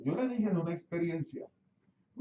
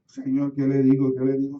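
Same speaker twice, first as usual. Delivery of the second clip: loud, urgent pleading to God; loud plea; 11 dB louder than usual. Retold or imitated. Pastor on the way to the hospital crying out to God, loud and urgent. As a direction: cry out the question louder, desperate.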